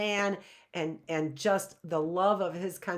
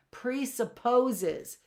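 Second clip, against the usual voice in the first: Presupposes stretched slowly; drawn-out key word; 4 semitones higher, much slower than usual. Shown as a demonstration. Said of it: "that's what that means".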